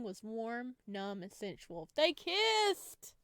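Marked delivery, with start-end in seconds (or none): 1.9-3.1 high, loud shriek; excited shriek. Real, not acted. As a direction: shriek with glee at the kiss. Shrieking at the kiss.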